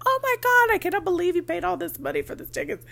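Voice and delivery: high, loud mock gasp of disbelief; shocked disbelief. Fan in the ticket line reacting to the price, shocked squeal. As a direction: squeal it like a scandalized stranger in line.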